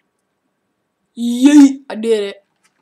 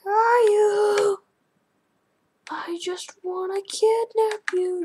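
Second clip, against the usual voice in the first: creepy hunter voice; 10 semitones higher than usual. Pretend. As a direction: call out like a playful stalker. Hunting the other player.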